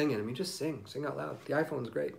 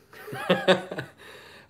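breathy laugh; 5 semitones higher, 4 dB louder than usual. Real, not acted. Laughs at own joke.

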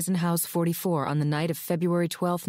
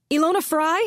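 "Ilona Fry" called out high and loud with a rising end; shouting; 10 semitones higher, 5 dB louder than usual. Acted. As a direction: shout "Ilona Fry?" through the door, higher and louder. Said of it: "pounded on Ilona Fry's door and shouted".

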